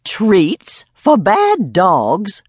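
indignant at treats for bad dogs; outraged. A narrator's reading. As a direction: drag it out in disbelief. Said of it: "Myrtle was outraged".

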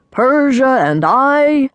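grand, measured announcement; proud, rich round voice. A narrator's reading. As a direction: draw it out proudly like wedding vows. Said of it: "he said proudly".

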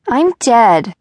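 loud, theatrical declaration to the sky; dramatic announcement. A narrator's reading. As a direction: proclaim it like a tragic actress. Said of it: "Eva announced to the sky".

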